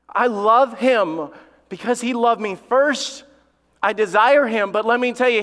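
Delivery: high, loud pulpit delivery; full-force preaching. Real, not acted. Preacher telling the church of God's love, full-force preaching.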